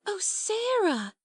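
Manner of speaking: high, drawn-out exclamation; sudden cry. A narrator's reading. Ermengarde struck by an inspiration, crying out. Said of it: "she cried".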